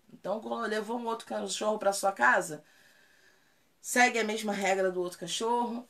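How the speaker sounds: usual speaking voice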